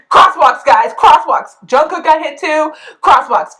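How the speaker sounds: hammed-up exasperated safety plea; mock ranting. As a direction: drum it into the viewers at full volume. Mock rant about crosswalk safety.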